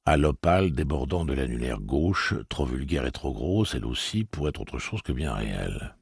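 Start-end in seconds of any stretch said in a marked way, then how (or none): none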